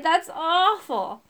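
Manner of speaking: high, drawn-out complaint about a foul taste; disgusted cry. A narrator's reading. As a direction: wail it slowly in disgust. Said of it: "I cried".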